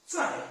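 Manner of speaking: "Zai" starting high and dropping fast; short, sharp fall on "Zai"; fourth tone. Shown as a demonstration. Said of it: "fourth tone".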